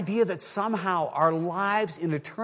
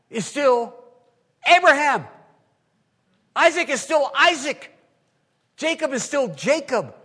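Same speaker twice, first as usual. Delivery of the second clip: preaching at full force; 7 semitones higher, 6 dB louder than usual. Acted. Preaching climax.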